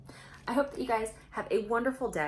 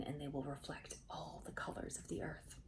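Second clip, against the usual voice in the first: soft secret whisper; hushed, wondering whisper; 5 semitones lower, 13 dB quieter than usual. Acted. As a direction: whisper it like a revelation. Spirit reveals a secret.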